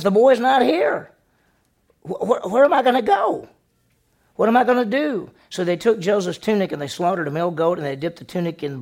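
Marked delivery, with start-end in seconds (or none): 0.0-5.3 high, loud, rising panic; frantic older brother losing it; panicked Reuben. Retold or imitated. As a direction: Reuben panicking over the empty pit. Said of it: "he's all upset".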